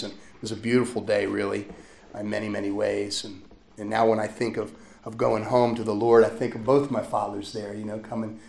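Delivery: normal talking voice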